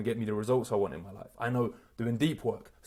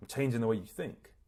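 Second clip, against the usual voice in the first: ordinary talk, nothing marked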